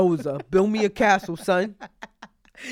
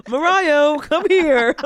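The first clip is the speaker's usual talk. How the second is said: louder, higher mom call; 8 semitones higher, 8 dB louder, slower than usual. Retold or imitated. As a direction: yell for the kid across the house. Mother calling child across house.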